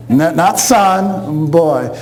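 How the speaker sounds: put-on slow "Not, not son" then a hard drop on "boy"; stress on boy. Shown as a demonstration. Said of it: "She called you boy".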